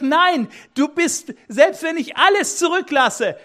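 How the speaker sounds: a loving father who won't let go; God's voice. Acted. God answers the lost sheep, high and fervent. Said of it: "Und Gott sagt".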